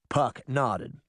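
ordinary delivery, nothing marked

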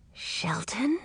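soft whispered call, rising; whispering so the shark won't hear. A narrator's reading. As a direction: hiss the name without moving. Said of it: "whispered Edric".

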